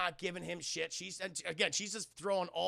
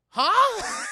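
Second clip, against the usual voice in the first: very high, loud baffled yelp; hammed-up shocked outburst; mock disbelief; 17 dB louder than usual. Man accused of wanting the sister, yelps in disbelief.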